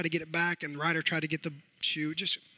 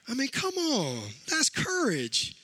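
proud emphasis; 4 semitones higher, slower than usual. Real, not acted. Proud of son forgiving dog.

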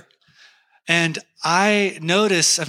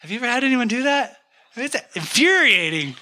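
indignant; 7 semitones higher, faster than usual. Real, not acted. Indignant aside about being filmed.

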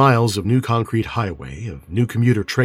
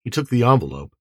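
usual speaking voice

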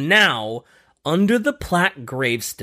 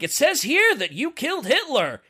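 higher, mildly amused official reading; God voice; 10 semitones higher than usual. Acted. God at the pearly gates reading the papers, lightly voiced. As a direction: read it off the file like a lightly voiced clerk.

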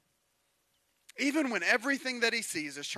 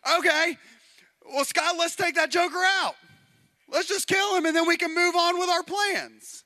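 lightly voiced scheming king; 8 semitones higher than usual.

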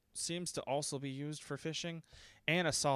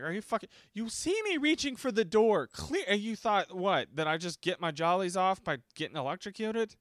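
higher, indignant outburst; retold angry rant; 7 semitones higher, 5 dB louder than usual. Retelling a row over getting electrocuted, indignant. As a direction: tear into them, incredulous.

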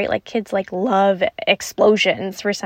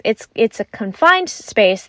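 usual speaking voice

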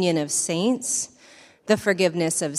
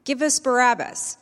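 mild shout; 6 semitones higher, 5 dB louder than usual. A narrator's reading. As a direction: read the crowd's shout with restraint, higher and louder. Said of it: "They shouted back".

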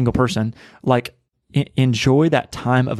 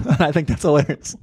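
laughing; 4 semitones higher than usual. Real, not acted. Laughing at his own impression.

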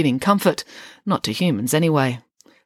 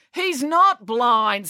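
slow, higher, emphatic correction; irritated, drawn-out retort; snapped; 7 semitones higher, 3 dB louder, much slower than usual. A narrator's reading. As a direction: snap it, stretching the words. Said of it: "snapped April".

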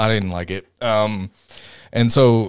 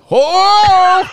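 long, high, loud whoop; shocked whoa; 6 dB louder than usual. Real, not acted. Co-host reacting to a burn, high shocked whoop. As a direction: cry out in real shock. Reacting to Tony's harsh line.

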